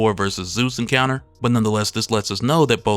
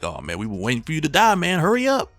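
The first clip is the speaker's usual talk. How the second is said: mock impatience; 5 semitones higher than usual. Jokingly voices impatient fans.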